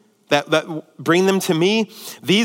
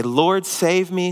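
said in faith; slower than usual. Shown as a demonstration. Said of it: "said in faith".

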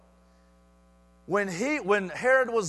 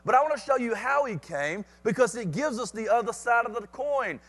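usual speaking voice

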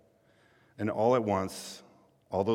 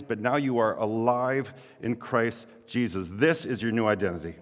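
building, louder sermon proclamation; preaching climax; 3 semitones higher, 5 dB louder than usual. Acted. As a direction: proclaim it with growing fervor.

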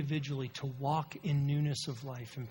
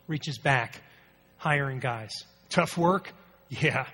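preaching; 7 dB louder, slower than usual. Real, not acted. Preaching at full force.

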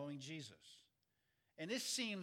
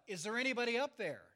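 fast, high yell for help; 6 semitones higher, 7 dB louder, faster than usual. Retold or imitated. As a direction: shout up from the cliff. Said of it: "he yells out".

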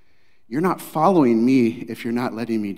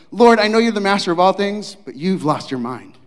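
higher and louder, mock outrage; incredulous Peter; 6 semitones higher, 7 dB louder than usual. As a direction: tell the Lord he's lost his mind. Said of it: "Better translated is like".

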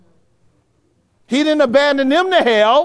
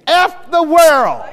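slow, louder mock outburst, pitched higher; defiant curse; 5 semitones higher, 6 dB louder, slower than usual. Preacher voicing Julia's outburst to the congregation, slow and loud. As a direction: spit it out like a defiant cry. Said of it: "somebody among us said the same thing Julia said".

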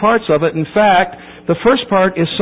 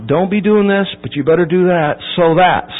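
ordinary delivery

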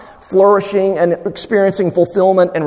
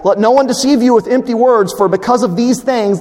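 higher, insistent verse reading; forceful, raised scripture quotation; emphatic; 6 semitones higher, 3 dB louder than usual. Acted. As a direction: drive the warning home. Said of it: "Again, very emphatic".

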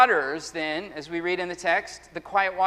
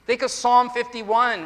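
ordinary talk, nothing marked

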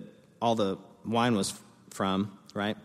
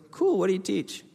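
higher keen cool; eager interest; 10 semitones higher than usual. Retold or imitated. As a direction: lean in excitedly. Retold excited wedding small talk.

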